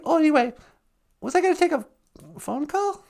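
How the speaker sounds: ordinary delivery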